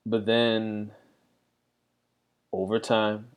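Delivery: ordinary delivery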